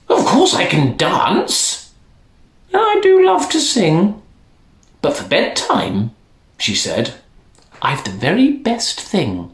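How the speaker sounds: a hint of a sing-song granny; old woman teasing the royals.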